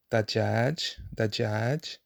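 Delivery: dajaj with a light, plain D. Shown as a demonstration. Teacher modelling the plain D in dajaj. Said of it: "this is a regular D sound".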